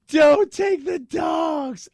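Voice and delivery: long, high, hammed-up wail; loud, stretched theatrical plea; mock desperate shout. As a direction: cry out in drawn-out mock despair. Acts out a plea to thieves.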